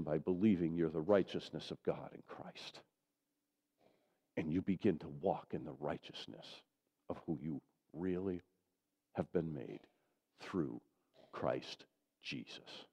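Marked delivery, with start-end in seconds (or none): 4.4-12.8 sinking low and soft, slowing at the end; hushed preaching. Real, not acted. Pastor closing on who they are in Christ, hushed. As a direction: let it fall to an intimate hush.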